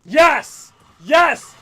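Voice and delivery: loud, thrilled cheer; excited shout. Real, not acted. Cheering a moment on screen, excited loud shouts. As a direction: yell it out in joy.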